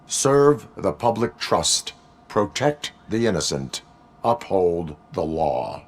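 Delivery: slow, deliberate cyborg delivery; measured recitation. Acted. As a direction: recite each directive slowly, with pauses between. Cyborg recites prime directives on request.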